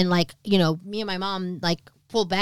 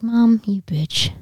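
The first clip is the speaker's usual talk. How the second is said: louder, slow drawn-out mock outrage; joking accusation; 4 semitones higher, 6 dB louder, much slower than usual. Daughter sees presents, mock outrage at mom. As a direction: scold playfully, stretching the words. Mock outburst at mother, then laughs.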